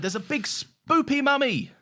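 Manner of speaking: high, slow, hammed-up proclamation; mock-dramatic announcement. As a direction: announce it with big silly drama. Mock-dramatic episode opening announcement.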